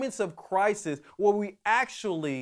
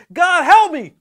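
a full-throated, much higher and louder plea; desperate cry for help; 13 semitones higher, 19 dB louder than usual. Retold or imitated. Alone in bed, calling out to God: desperate shout. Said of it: "I just call out there".

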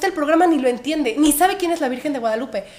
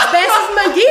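higher, louder playful flirt with laughs; laughing mock flirt; 7 semitones higher, 8 dB louder than usual. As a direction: coo it like a cheesy flirt, laughing. Imita piropo del agente, riendo. (piropo burlón entre risas)

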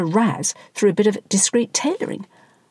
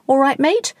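called out high and louder; shouted down; 7 semitones higher, 4 dB louder than usual. Acted. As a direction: shout down to the fallen cyclist. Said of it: "He shouted down".

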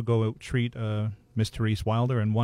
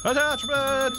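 much higher laughing repeat of one word; teasing squeal; slower than usual. Real, not acted. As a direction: squeal the word back in glee. Teasing friend's drink remark.

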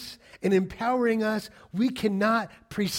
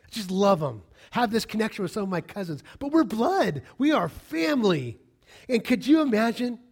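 normal talking voice

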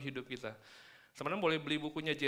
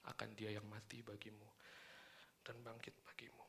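whispered; 4 semitones lower, 17 dB quieter than usual. Acted. Sermon point dropped to whisper.

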